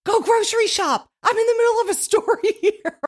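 high, irritated mock retort; exasperated wife. Wife brushing off husband mid-story, mock exasperation. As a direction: squeal it back, hammed-up annoyance. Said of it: "And I'm like".

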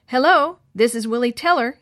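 high, loud phone greeting, slightly winded; out of breath. A narrator's reading. Willie answers the phone after racing down the hall, winded. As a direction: answer as if just rushed to the phone. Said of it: "said to the phone out of breath".

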